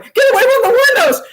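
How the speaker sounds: high, loud panicked yell through laughter; frantic shout, laughing. Retold or imitated. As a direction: bellow it like a panicked owner, half laughing. Owner shouting warning, retold laughing.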